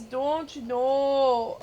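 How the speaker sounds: very slow, drawn-out Minnesota "Don't you know?"; mock Minnesota drawl. Imitating friend's Minnesota accent, slow and hammed-up. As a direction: stretch every vowel like a Fargo local. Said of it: "he says".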